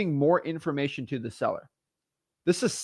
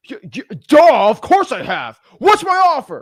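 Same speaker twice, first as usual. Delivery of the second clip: impatient seller; 9 semitones higher, 10 dB louder, slower than usual. Retold or imitated. Seller snaps back.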